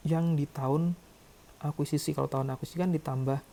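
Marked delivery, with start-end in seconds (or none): none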